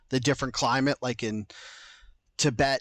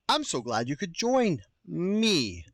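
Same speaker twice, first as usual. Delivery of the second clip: slow, higher, swooping welcome; weird host voice; 4 semitones higher than usual. Real, not acted. Host redoing his welcome to listeners, slow and weird. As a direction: draw out the greeting in an oddly formal lilt. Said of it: "I'm talking so weird".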